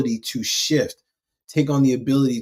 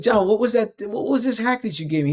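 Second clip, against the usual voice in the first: raised pitch, light mock complaint; a touch of victim whine; 9 semitones higher than usual. [name] asking John about the hack, mildly whining. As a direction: ask again, half-whining. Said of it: "Victim ass language".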